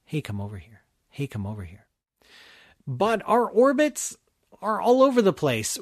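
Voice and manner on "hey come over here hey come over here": soft low repeated beckoning; quiet coaxing. Pretend. Jupiter endlessly luring Earth, soft coaxing. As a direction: murmur the call over and over. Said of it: "we're hearing that whisper all the time".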